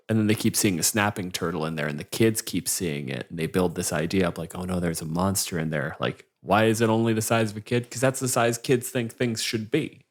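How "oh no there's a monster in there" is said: normal talking voice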